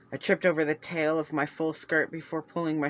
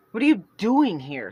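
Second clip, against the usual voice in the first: louder, curt snap; snapped; 3 semitones higher, 7 dB louder than usual. Acted. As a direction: snap the question at her. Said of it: "Celeste snapped".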